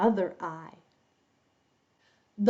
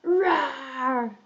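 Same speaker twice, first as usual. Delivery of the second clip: big rough lion's roar; 3 semitones higher than usual. Shown as a demonstration. Teacher shows children the story cue, big lion roar. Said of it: "Again, lion".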